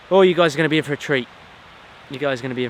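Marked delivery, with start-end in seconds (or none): 0.1-1.3 fast, high and loud, bursting with excitement; excited. Real, not acted. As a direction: gush about the treat ahead. Excited promise to viewers.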